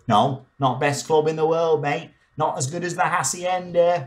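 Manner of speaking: mock Manc clubber. Voicing a Manc clubber correcting.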